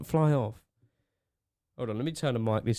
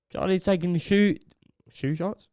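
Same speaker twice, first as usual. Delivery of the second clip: ordinary talk, nothing marked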